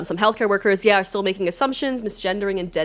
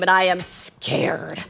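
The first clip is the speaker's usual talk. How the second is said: ordinary talk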